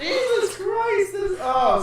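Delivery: high, slow laughing exclamation; real laughter at a disturbing picture. Real, not acted. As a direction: laugh out the words in horror.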